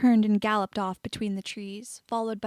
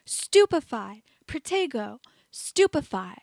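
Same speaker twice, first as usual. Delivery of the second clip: louder, higher battle cries; shouting spells; 3 semitones higher, 4 dB louder than usual. A narrator's reading. Auror fighting blind, shouting spells. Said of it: "The Auror started shouting".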